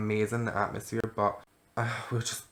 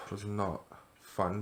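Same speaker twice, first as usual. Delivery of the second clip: weary; 8 dB quieter, slower than usual. Real, not acted. Sighing about hangover.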